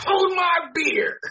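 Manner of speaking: high, loud, slow mock boast; cocky boast. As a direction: shout it slow like a cocky dare. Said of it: "here's a guy's like".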